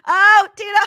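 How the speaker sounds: high, loud wail; hammed-up cry for help; mock crying. Crying out to Tito, mock crying.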